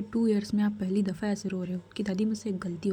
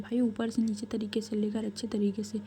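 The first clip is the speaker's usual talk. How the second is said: plain reading voice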